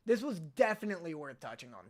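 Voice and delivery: usual speaking voice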